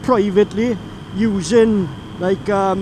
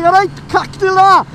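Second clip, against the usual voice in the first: mock Turkish-accented greeting; a playful imitation of a Turkish accent; lightly done Turkish accent; 8 semitones higher, 5 dB louder than usual. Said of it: "such a broad Turk accent there".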